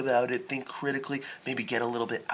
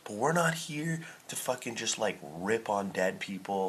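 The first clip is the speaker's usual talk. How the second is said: normal talking voice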